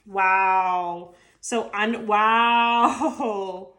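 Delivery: real tearful wow, voice catching; overwhelmed by emotion at the card; choked up. Real, not acted. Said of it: "I'm actually getting choked up".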